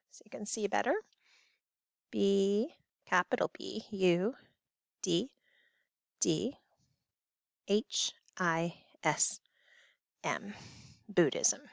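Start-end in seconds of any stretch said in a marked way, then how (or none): 2.1-11.6 spelling out. Shown as a demonstration. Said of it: "spelling out for notes".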